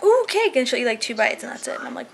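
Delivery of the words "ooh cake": high, gushing excitement; mock delighted child. Mom imitating daughter's delight at cake, high and gushing. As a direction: squeal over the cake. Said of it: "she'll be like".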